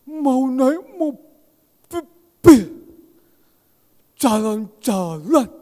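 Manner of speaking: put-on high, very slow, childlike drawl; slow pleading for a car ride; Wahyu asking. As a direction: stretch each word like an eager child begging for a ride. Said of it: "Orangnya kan memang ngomongnya kayak gitu".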